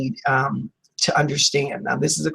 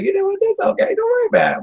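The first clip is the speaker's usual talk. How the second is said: high, sing-song mock drunk nonchalance; carefree tipsy shrug; faster than usual. Tipsy person told the house burns, shrugging it off lightly. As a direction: breezily wave off a stolen car.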